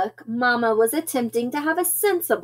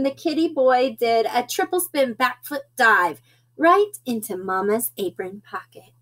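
ordinary delivery

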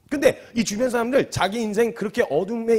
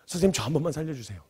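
low, soft, meek begging; acted humble appeal, lower and hushed; humble pleading; 4 semitones lower, 6 dB quieter than usual. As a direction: plead with your head bowed. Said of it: "머리부터 숙이는 거예요".

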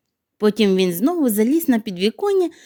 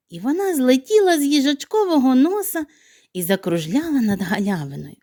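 usual speaking voice

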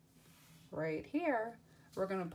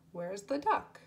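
ordinary talk, nothing marked

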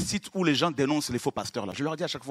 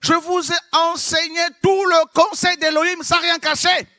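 apostle Paul quoted; 13 semitones higher, 9 dB louder, slower than usual. Retold or imitated. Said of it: "L'apôtre Paul dit".

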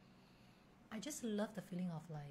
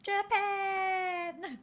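high, loud, drawn-out cheer; excited shout; 12 semitones higher, 9 dB louder than usual. Real, not acted. Answering friend's question on where to go, excited shout. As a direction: call it out like a dream destination.

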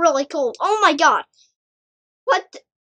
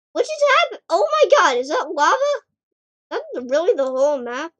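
normal talking voice

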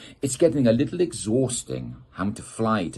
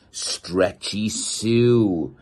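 stretched-out name; 3 semitones higher, 6 dB louder, much slower than usual. Acted. Introducing a stretchy superhero.

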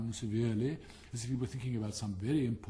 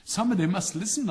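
much higher, louder cheerful retort; smug chatty frog; 6 semitones higher, 8 dB louder than usual. Acted. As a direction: answer brightly, unbothered. Frog character voice.

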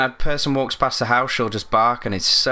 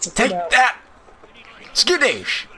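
cartoon battle cry; 9 semitones higher, much slower than usual. Cartoon battle cry while fighting.

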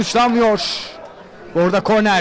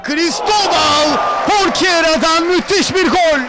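screaming football commentator; 4 semitones higher than usual. Real, not acted. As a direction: stretch the name out, yelling it. Third goal call.

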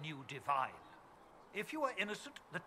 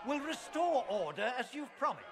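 louder and higher, demanding an answer; indignant raised challenge; challenging raised voice; 6 semitones higher, 5 dB louder than usual. Acted. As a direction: call him out before everyone. Chancellor challenges the commander publicly.

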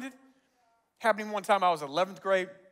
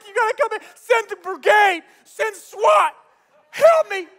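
higher, louder desperate cry; frantic plea for help; 8 semitones higher, 9 dB louder than usual. Retold or imitated. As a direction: plead with 911 in panic.

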